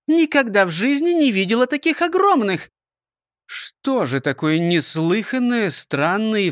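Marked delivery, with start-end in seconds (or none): 0.1-2.7 high, amazed storybook voice; amazed exclamation. Acted. Character marvels at the biggest ones ever seen, amazed. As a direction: marvel at it, pitch lifted high.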